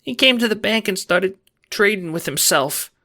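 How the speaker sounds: high, frightened, strained report; forcing out words. A narrator's reading. Employee informing on someone to the Don, high and fearful. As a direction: make yourself speak despite the fear. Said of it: "have to force yourself to speak".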